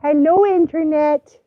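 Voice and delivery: high, loud voice for the dog. Real, not acted. Owner voicing her dog greeting the internet, high and loud. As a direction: speak as the dog greeting viewers.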